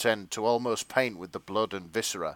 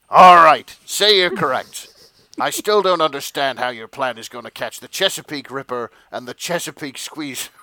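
loud, higher, blustering chief, cracking into laughter at the end; shouty police chief; 3 semitones higher, 8 dB louder than usual. Acted. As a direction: yell it like an exasperated newspaper editor. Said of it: "police chiefs should sound a little bit like".

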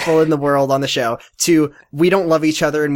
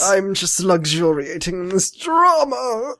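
slow British luxuriating; hammed-up British relish, words stretched out; posh British put-on; 3 semitones higher, slower than usual. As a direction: languish in a silly British accent. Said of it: "it made me British".

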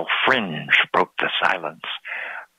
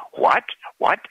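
high, startled "What, what?"; great surprise; 11 semitones higher than usual. A narrator's reading. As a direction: ask it as if you can't believe your ears. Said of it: "asked they in great surprise".

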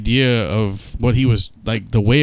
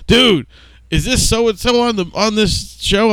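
a pitched-up eager question; excited friend; 11 semitones higher, slower than usual. Retold or imitated. Friend asking about the show, voiced high and excited. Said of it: "sent me a message saying".

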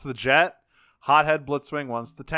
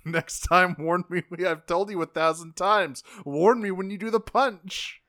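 exasperated scolding; 6 semitones higher than usual. Retold or imitated. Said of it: "smacking him on the back of the head and going".

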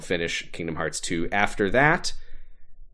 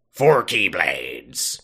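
slow, drawn-out pirate growl; 5 semitones lower, much slower than usual. Pretend. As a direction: talk like a pirate captain. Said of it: "it's a pirate voice now".

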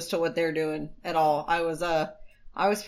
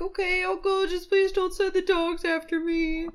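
mock scared child; 11 semitones higher than usual. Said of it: "starts crying because he's terrified".

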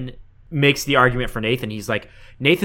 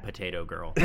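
laughing; 6 semitones higher than usual. Real, not acted. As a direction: say it as the laugh breaks out. Laughing punchline.

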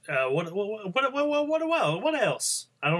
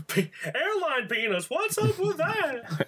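mock Seinfeld; 14 semitones higher, slower than usual. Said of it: "terrible attempt at a Seinfeld impression".

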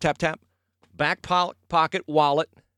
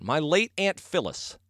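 ordinary delivery

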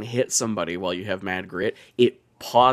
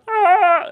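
short high yelped "ah" of a game character; mock scream; 7 dB louder than usual. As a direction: imitate a survivor's pained cry. Imitating in-game survivor scream.

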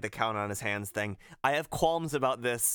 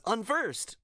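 Ventus; 10 semitones higher than usual. Acted. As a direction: announce the enemy in a young hero's voice. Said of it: "he says".

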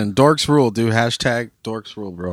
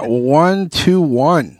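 slow drawn count; 3 semitones higher, much slower than usual. Pretend. Slow repeat of episode number.

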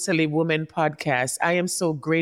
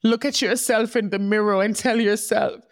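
higher, tearful, emotional voice; tearing up; 4 semitones higher than usual. Real, not acted. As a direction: speak through real tears, still encouraging. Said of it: "I don't know why I'm tearing up like this".

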